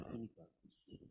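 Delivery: hushed, nearly whispered "Ini bagus"; parent's emphasis. Shown as a demonstration. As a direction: drop "Ini bagus" to a soft, meaningful hush. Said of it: "has a different way to it".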